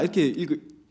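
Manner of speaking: usual speaking voice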